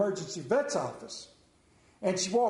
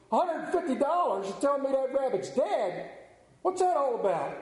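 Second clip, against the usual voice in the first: higher, indignant woman shocked by the bill; outraged customer; 7 semitones higher than usual. Acted. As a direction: squawk at the price in disbelief.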